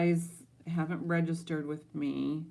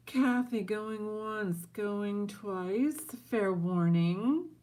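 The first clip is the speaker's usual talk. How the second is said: slow, higher sing-song countdown; auctioneer call; 5 semitones higher, 4 dB louder, much slower than usual. Real, not acted.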